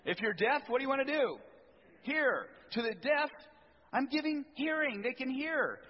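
high, loud joyful preaching; jubilant preaching. Acted. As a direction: preach it with rising joy. Preaching climax on healing.

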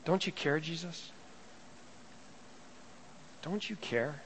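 soft, pleading question; hushed plea. Retold or imitated. Preacher echoing the disciples' plea to Jesus, soft and hushed. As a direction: let it sink, nearly under the breath.

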